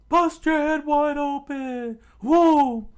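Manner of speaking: very high, loud squeaky taunt; taunting shout. Acted. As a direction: jeer as you jump away. Usopp leaping over the wall.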